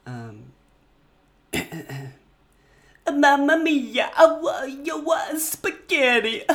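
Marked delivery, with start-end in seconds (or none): 3.1-6.4 high, loud hammed-up Italian accent; overdone Italian accent, belted out high. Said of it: "the audition of, um, Mister Italian".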